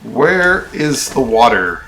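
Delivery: loud, drawn-out singsong taunt; mock calling out. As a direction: call to the bear in mock sweetness, stretching it out. Playfully addressing the bear while hunting water.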